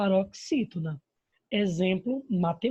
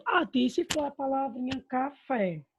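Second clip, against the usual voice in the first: higher-pitched pupil's question; imagined pupil asking; 5 semitones higher than usual. Acted. Pupil asks teacher about the word café, higher curious voice. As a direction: pipe up like a pupil interrupting the teacher.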